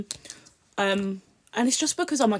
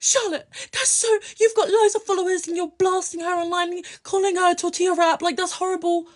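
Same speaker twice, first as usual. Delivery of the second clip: much higher, prissy outraged voice; mock scandalised followers; 8 semitones higher than usual. Imitating followers scolding her for the tortilla insult, high prissy outrage. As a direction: play the shocked goody-two-shoes fan. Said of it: "Everyone was like".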